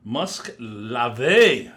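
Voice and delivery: loud, drawn-out mock announcer call; playful booming product reveal; showy announcement. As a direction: belt out the name slowly, with relish. Grandly announces the next scent.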